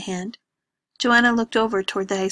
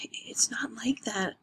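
slow, hushed denial; hushed tearful denial; whispered protest; 2 semitones higher, 6 dB quieter, slower than usual. Acted. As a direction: whisper it so as not to wake anyone. Lena protests quietly at night.

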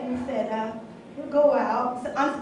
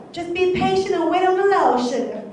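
higher, louder mock church elder, softening on the last word; imitated church mother; 5 semitones higher, 4 dB louder than usual. Fed-up single woman mimicking church mother, mock sweet counsel. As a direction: parody a church mother's pat advice. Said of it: "the pastor or the church mother".

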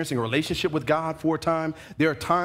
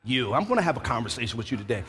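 mock confrontation; 6 semitones lower, 3 dB quieter than usual. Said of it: "That's why we like".

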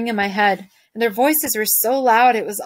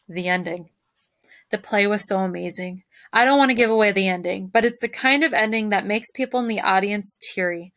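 ordinary delivery

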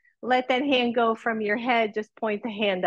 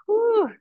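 high whew; 11 semitones higher, 4 dB louder than usual. Real, not acted. Exhale during yoga.

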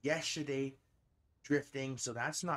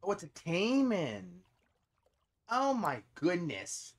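real excitement; 4 semitones higher, 6 dB louder than usual. Real, not acted. Hooks a big taimen.

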